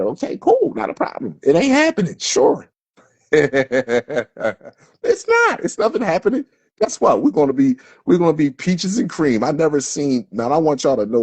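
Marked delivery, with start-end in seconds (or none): 0.0-6.5 much higher, sing-song sarcasm with a laugh; sarcastic mock agreement. Real, not acted. Mocking viewers who deny what's coming, high sarcastic sing-song. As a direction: fake agreement dripping with scorn.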